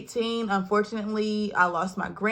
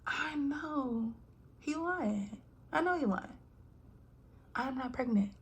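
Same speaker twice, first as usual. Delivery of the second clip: hushed, higher inner voice; inner thought whisper; 4 semitones higher, 12 dB quieter than usual. Retold or imitated. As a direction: whisper it to yourself in disbelief. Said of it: "I'm thinking to myself".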